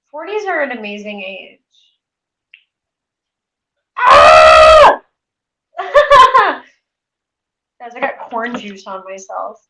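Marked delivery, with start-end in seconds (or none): 4.0-5.0 sudden loud yelp of surprise; startled scream; startled shout. Real, not acted. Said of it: "I shouted". 5.7-6.7 big burst of laughter after the scare; high loud laugh; loud startled laugh. Real, not acted.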